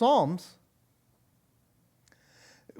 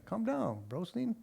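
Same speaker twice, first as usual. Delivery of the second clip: calm big brother; 4 semitones higher, 12 dB quieter than usual. Retold or imitated. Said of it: "He's going".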